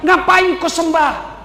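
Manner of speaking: a hint of a jeering devil; satan taunting. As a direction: drag out the taunt. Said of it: "Kata setan".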